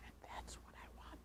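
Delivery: breathy whispered aside; hushed confiding whisper. Real, not acted. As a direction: drop to a whisper for the camera.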